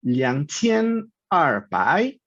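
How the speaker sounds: slow, syllable-by-syllable Mandarin numbers; Mandarin pronunciation demo. Shown as a demonstration. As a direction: sound out each Mandarin syllable slowly, tones distinct.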